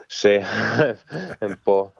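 ordinary talk, nothing marked

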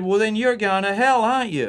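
put-on high, insistent kid-sister voice; mock big sister. Sister pressing her little brother, in a high kid voice. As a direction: pitch it way up like a pushy seven-year-old. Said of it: "and she said".

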